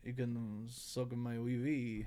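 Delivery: slow mock Spanish accent; a silly Spanish accent, drawn out. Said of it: "I was doing this accent".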